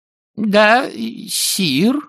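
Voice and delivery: high-pitched underling correcting himself; distant voice. A narrator's reading. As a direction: squeak a reply from the far end. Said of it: "Далёкий голос что-то пробормотал под нос и добавил". (далёкий писклявый голос подчинённого)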